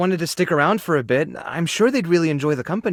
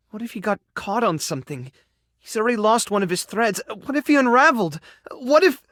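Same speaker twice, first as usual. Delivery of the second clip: spiral from worry toward panic; rising worry; 4 semitones higher than usual. Acted. Spiralling worry over missing friend.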